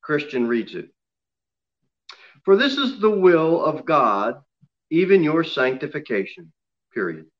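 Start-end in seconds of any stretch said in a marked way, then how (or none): 2.4-7.3 reading with full stop. Shown as a demonstration. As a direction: close "sanctification" off with a final fall.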